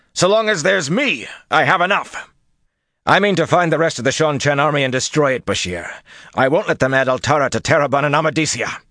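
loud, high, forceful commander's tirade; angry rebuke. A narrator's reading. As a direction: dress down an officer at full voice. Commander angrily rebukes his officer.

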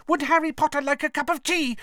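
high, eager little elf squeak; squeaky elf voice. A narrator's reading. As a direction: squeak it out cheerfully. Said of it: "he squeaked loudly".

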